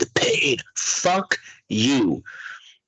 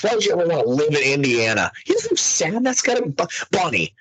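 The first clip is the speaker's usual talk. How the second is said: normal talking voice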